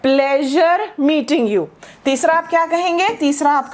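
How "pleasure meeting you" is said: drawn-out and deliberate; teacher drawing out the phrase for learners; very slow model phrase. Shown as a demonstration. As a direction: stretch each word for learners. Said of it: "तीसरा आप ये कह सकते हैं".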